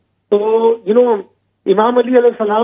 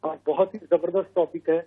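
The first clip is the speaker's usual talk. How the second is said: too quiet; 2 semitones lower, 7 dB quieter than usual. Real, not acted. Caller praising the hosts' programme, too quiet. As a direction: talk softly, hard to hear. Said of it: "آواز کم آ رہی ہے بہت".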